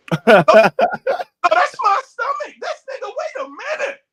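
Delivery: high wailing half-laugh, half-cry; mock sobbing in disbelief; hammed-up laughing wail; laughing despair. Retold horror at photo.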